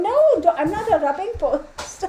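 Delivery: high, loud squeal breaking into laughter; amused high-pitched objection; laughing protest. Real, not acted. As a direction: protest to a pushy dog through a laugh. Laughing protest at pushy dog.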